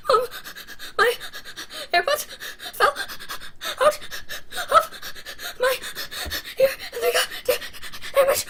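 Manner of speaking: high, loud mock sobbing between words; exaggerated bawling, word by word; hammed-up crying. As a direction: wail to mom in gasping fake sobs. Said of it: "I'm like that right now".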